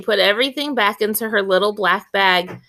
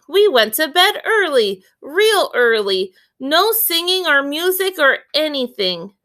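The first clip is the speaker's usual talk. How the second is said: put-on young boy voice; 6 semitones higher than usual. Narrator voices little Willie.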